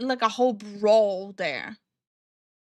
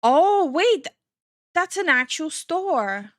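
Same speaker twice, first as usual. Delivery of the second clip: a high, loud "oh, wait" of surprise; sudden realization; 5 semitones higher, 5 dB louder, slower than usual. Real, not acted. Streamer realizing the store is real, surprised. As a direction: catch on all at once.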